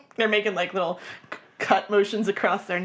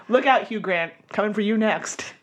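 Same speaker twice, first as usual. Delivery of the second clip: normal talking voice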